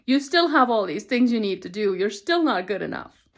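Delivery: inner critic voice. Retold or imitated. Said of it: "another voice that says".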